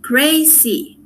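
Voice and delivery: "Crazy" in two separated syllables for counting. Shown as a demonstration. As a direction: sound out "Crazy" one syllable at a time. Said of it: "dos sílabas".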